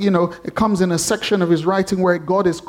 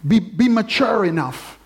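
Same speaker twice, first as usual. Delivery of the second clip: normal talking voice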